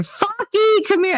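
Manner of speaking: put-on high-pitched kid calling out; little girl calling dog. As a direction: call the dog in a high child's voice. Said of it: "she couldn't say Sparky".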